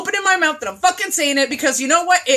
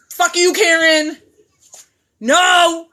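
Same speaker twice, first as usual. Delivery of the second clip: louder, higher, slower fuck-you; defiant retort; 4 semitones higher, 4 dB louder, much slower than usual. Retold or imitated. Telling off a Karen online, defiant louder retort. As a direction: spit it at the troll.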